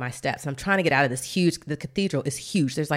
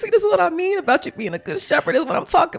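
crying out to God, pitched high; overwhelmed cry to God in a much higher voice; tearful, much higher prayer; 4 dB louder than usual. Retold or imitated.

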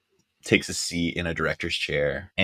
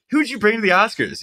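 much higher, quick put-on voice; imitating George Clooney. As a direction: rush the question up high. Repeating question, voiced Clooney.

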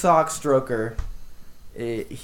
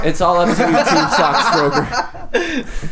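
laughing; 4 semitones higher than usual. Real, not acted. Laughing while answering.

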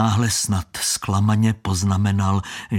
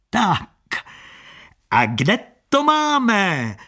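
higher, louder, slow barker call; unusually loud shout; 10 semitones higher, 3 dB louder, slower than usual. Acted. Con man calling out to attract onlookers, loud and slow. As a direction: shout to draw in the crowd. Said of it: "nezvykle silně zakřičel muž".

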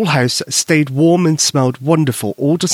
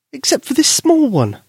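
put-on higher child voice calling down; high girl calling down; 13 semitones higher than usual. Susie calling down from the chair, high girl voice. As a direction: call down from the shelf.

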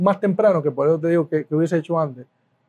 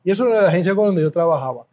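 ordinary talk

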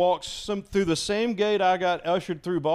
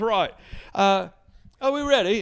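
higher, louder British rock star; 6 semitones higher, 5 dB louder than usual. Retold or imitated. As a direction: call out to the crew Brit-style. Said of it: "He goes".

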